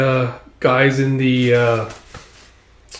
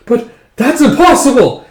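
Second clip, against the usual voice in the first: high, loud protest; acted shock, voice shooting up; loud disbelief; 10 semitones higher, 7 dB louder than usual. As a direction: burst out in disbelief. Acted disbelief at the test result.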